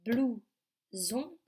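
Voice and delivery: "blouson" split as "blou... zon"; teacher separating the two syllables of "blouson". Shown as a demonstration. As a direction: leave a clear gap between "blou" and "zon". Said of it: "un mot de deux syllabes".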